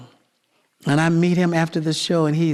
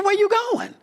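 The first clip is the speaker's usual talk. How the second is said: woman's voice; faster than usual. Retold or imitated. Voicing the woman.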